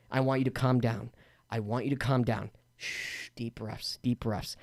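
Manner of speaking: low, soft, soothing hush; retold calming. Storyteller re-enacting calming the knife-wielding houseguest, low and hushed. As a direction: talk someone down gently, keeping it low.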